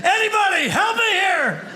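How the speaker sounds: high, strained comic scream; screaming for help. Acted. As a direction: cry out for a spotter mid-rep. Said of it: "screaming for a spot".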